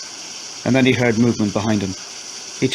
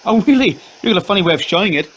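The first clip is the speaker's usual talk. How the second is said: bitter sarcasm; 8 semitones higher, faster than usual. Acted. Wife's bitter retort in quarrel.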